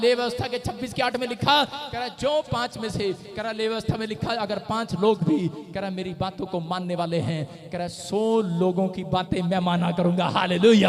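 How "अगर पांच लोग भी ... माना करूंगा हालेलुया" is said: slow, emphatic preaching build; full-force preaching. Acted. Preacher to congregation, full force preaching. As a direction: drive it home to the congregation.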